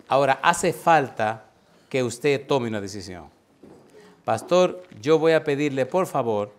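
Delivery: loud, fervent altar-call preaching; preaching at full force. Acted. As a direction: drive the call home to the congregation. (predicación fervorosa y fuerte)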